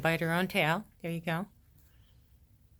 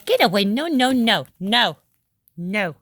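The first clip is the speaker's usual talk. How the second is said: loud, firm telling-off; scolding; 7 dB louder than usual. Real, not acted. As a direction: scold the puppy off the rug, loudly. Scolding the puppy.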